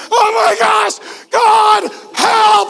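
high, loud frantic cry for help; mock panic. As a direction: shout like you're drowning. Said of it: "you're like".

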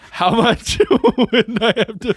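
high, loud and slow through laughter; laughing. Real, not acted. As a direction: giggle the question out. Joking bribe, laughing.

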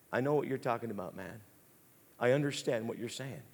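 low, soft, confiding biker; lightly voiced biker, quiet and low; softened biker. Tough biker opening up to a church greeter, softened. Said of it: "Some biker guy that's carrying two guns".

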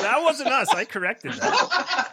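high, laughing denial; laughing protest. Real, not acted. Denying the Kenora claim to his cohost, laughing high. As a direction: squeal it out through laughter.

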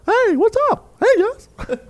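very high squeaky puppet voice. Pretend. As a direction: chirp it like a hand puppet. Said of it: "I dress up as you".

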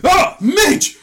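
high, loud startled cry; mock surprised greeting. Will Ferrell character spotting Midge, mock surprise. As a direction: exclaim her name in surprise.

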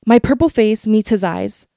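plain reading voice

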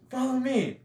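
high-pitched mock call; shouting across party. Dickie summons Tommy across the party, calling out. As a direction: imitate beckoning over a crowd. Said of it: "Why are they shouting across the party?".

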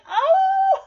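very high, loud drawn-out "oh"; emotional squeal. Acted. Cat and dogs reunited, high overwhelmed squeal. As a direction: wail it high in mock joy.

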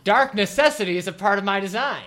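loud, high, hammed-up retort; mock declamation. Reader answering Harry Potter blurb with lyric, hammed-up retort. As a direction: throw it back grandly, high and loud.

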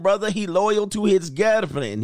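playful mock West African accent; bad West African accent. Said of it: "how to do the African accent".

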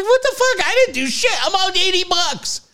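high-pitched outraged rant; heated mock outrage, pitch way up. Man grumbling about losing eighty bucks, heated and high. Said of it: "man, I'm pretty heated".

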